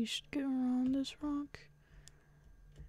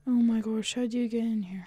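ordinary talk, nothing marked

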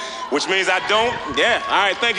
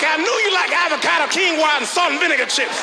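higher, teasing know-it-all friends; knowing mock voice; 8 semitones higher than usual. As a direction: lord it over him like you always knew. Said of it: "They was like".

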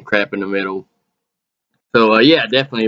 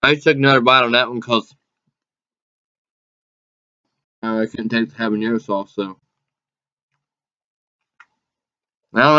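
speaking between chews; talking while chewing; faster than usual. Real, not acted. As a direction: mouth partly full of food. Seen 2: Bite at -4s; cheeks bulge, jaw chewing while speaking 0-6s.

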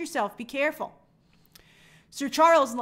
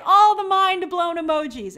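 excited exclamation; 6 semitones higher, 9 dB louder than usual. Real, not acted. Reacting to viewer praise.

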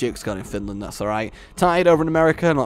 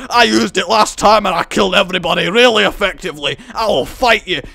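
put-on loud, rushed Scottish accent; Scottish doctor; 4 semitones higher, 7 dB louder, faster than usual. Scottish doctor challenges rivals to fight, loud Scottish accent. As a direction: rant as a combative Scot. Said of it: "I'm having a free accented conversation".

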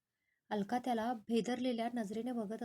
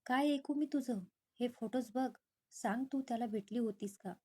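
plain reading voice

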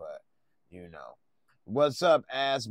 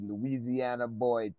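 ordinary talk, nothing marked

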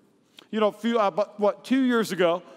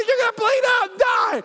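much higher shrieking mock wail; panicked mother-in-law; 12 semitones higher, 4 dB louder than usual. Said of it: "She said".

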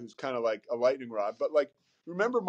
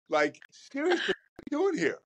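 pitched-up self-scolding; 7 semitones higher than usual. Retold or imitated. Inner voice telling self off.

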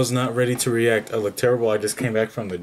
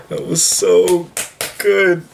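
higher, louder, slow gushing repeat; more emotional; 7 semitones higher, 5 dB louder, much slower than usual. Real, not acted. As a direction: stretch out the so. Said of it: "I gotta stop getting emotional".

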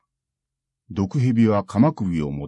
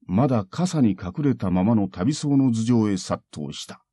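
plain reading voice

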